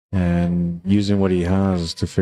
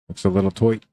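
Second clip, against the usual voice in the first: put-on a quick jokey stab at an Australian accent; playful put-on Australian accent. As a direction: toss it off in a mock Australian accent. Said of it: "That was my Australian accent".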